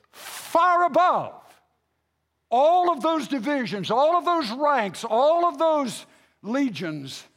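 slow, ringing scripture proclamation; forceful preaching. Acted. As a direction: proclaim it from the pulpit, hitting each phrase.

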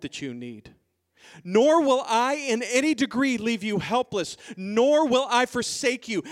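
forceful, ringing scripture declaration; emphatic promise. Acted. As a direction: drive each clause home as God's solemn promise. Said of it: "talking like that".

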